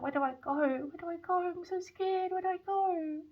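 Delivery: high, plaintive, frightened little voice; imitated scared child. Scared child in a dream begging for directions, imitated fearful wail. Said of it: "He's scared".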